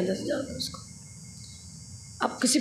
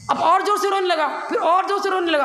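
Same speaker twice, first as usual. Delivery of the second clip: high, pained telling of weeping; narrated sobbing climax; rising grief; 7 semitones higher than usual. A narrator's reading. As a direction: pitch the crying up high. Thief weeps harder.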